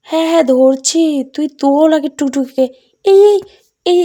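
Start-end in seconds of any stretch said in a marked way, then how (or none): 3.0-4.0 sudden high alarmed call; alarmed cry. Acted. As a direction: cry out as her grip slips. Friend's hand slipping while climbing. (ভয়ে চেঁচিয়ে ডাকা)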